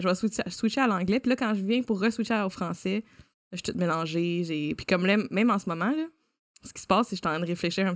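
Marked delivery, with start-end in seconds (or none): none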